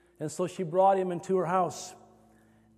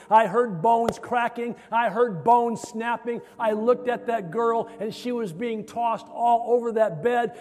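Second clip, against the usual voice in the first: raised, driving preacher's crescendo; fervent testimony; 4 semitones higher, 4 dB louder than usual. Retold or imitated. Violinist telling students of the healing, fervent and building. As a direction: build urgently, pitch held high, punching the phrases.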